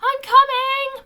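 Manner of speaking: panting loud high call, slowly; out of breath. A narrator's reading. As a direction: shout it out while panting hard. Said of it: "panted Bungo".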